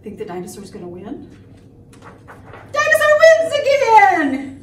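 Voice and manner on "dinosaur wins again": high, loud victory call; triumphant shout. Acted. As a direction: crow the winner to the room. Proclaims the dinosaur's victory.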